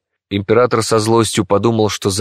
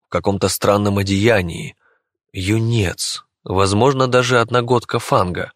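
ordinary delivery, nothing marked

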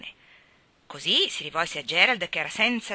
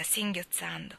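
usual speaking voice

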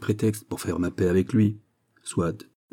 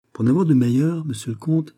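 mock-solemn, pitched up; comic seriousness; 7 semitones higher than usual. A narrator's reading. Manicamp teases the count with mock seriousness. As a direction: play it earnest with a comic edge. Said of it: "avec un sérieux comique".

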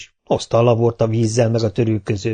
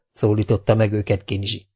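ordinary delivery, nothing marked

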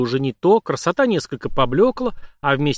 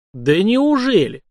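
slow, higher, drawn-out mock surprise; self-satisfied irony; 6 semitones higher, 4 dB louder, slower than usual. A narrator's reading. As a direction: relish the effect with a stretched question. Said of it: "пробурчал довольный произведённым эффектом государь". (с довольной иронией, протяжно)

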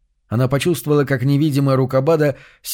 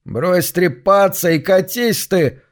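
grumbling; 6 semitones higher, slower than usual. A narrator's reading. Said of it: "буркнул сам себе Лен".

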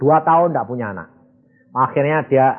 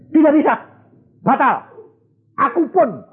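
1 firm refusal; 10 semitones higher than usual. Retold or imitated. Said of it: "Dia bilang".

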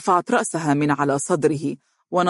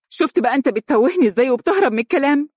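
quick, high-pitched accusing question; serious; 9 semitones higher, faster than usual. A narrator's reading. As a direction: confront him in earnest, pitch well up. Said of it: "وقالت بجدية".

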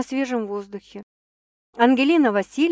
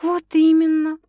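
high, loud perplexed agreement; troubled woman, pitch raised; puzzled; 8 semitones higher, 6 dB louder than usual. A narrator's reading. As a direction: agree with dawning concern. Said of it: "озадаченно покачала она головой".

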